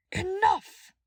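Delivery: very loud, high command; shouted. A narrator's reading. Dimitri halting the chamber, commanding shout. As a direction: bark the room silent. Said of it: "Dimitri shouted".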